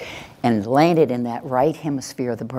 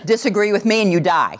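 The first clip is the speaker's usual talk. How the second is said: higher, threatening demo voice; aggressive; 5 semitones higher than usual. Shown as a demonstration. Trainer demonstrating an aggressive voice for laughs, menacing. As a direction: lay it down as a hammed-up threat. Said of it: "An aggressive voice is".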